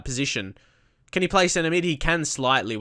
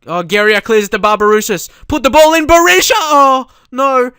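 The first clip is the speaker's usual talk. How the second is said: higher and much louder frantic yelling; screaming excitement; 13 semitones higher, 13 dB louder than usual. Real, not acted. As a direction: scream for the goal that is coming. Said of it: "big reaction".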